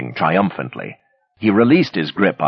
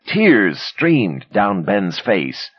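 plain reading voice

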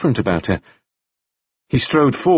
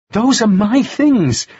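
high, slow, upset objection; indignant protest; 10 semitones higher, slower than usual. A narrator's reading. Woman objecting as the man searches her belongings, indignant.

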